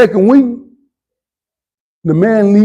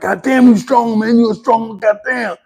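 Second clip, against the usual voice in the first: retold scream; 6 semitones higher than usual. Sparring partner jumping up and down at his strength, mock yell. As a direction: imitate an awed sparring partner crying out. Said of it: "He would just scream to me like".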